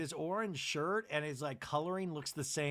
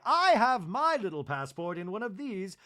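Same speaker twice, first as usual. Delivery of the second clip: loud chirpy gift-giver; 4 semitones higher, 7 dB louder than usual. Retold or imitated. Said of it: "Bronwyn's like".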